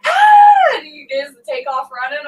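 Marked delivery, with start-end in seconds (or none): 0.1-0.8 high, loud imitated shriek; retold scream. Mimicking her husband's scared scream for viewers, high loud shriek. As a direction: shriek like a scared little girl. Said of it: "he's going".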